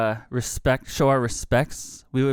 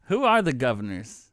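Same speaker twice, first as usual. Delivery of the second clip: usual speaking voice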